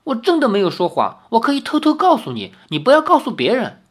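put-on high, fast child pleading; an eager boy sharing a secret; urgent, high little boy voice; anxious child. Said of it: "那个孩童有点心急".

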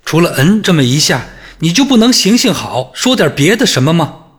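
high, loud, impatient reproach; exasperated scolding. A narrator's reading. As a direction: scold him with open irritation. Julia scolding Arthur.

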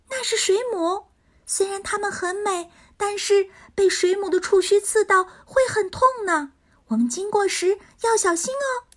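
high little pixie voice, gently warning; a cute pixie cautioning friends. Acted. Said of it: "小精灵提醒道".

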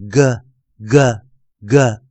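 "g" as a hard /g/ with a short schwa, each one separate; phonics sound demo. Shown as a demonstration. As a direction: punch out "g" as a short "guh", with pauses between. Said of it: "老师刚刚的读音是". (把"g"读成短促的"格"音，一个一个分开读)

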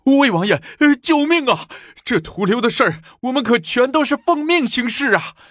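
very high, loud wail; desperate pleading. A narrator's reading. Kneeling envoys beg the prince to save them, desperate pleading. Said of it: "向武承嗣求情".

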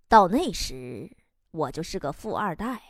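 low, slow and deliberate; slow, unhurried reply. Retold or imitated. Husband with a cigarette answering his wife, slow and low. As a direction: drop the pitch and let each phrase come slowly. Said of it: "缓缓地说".

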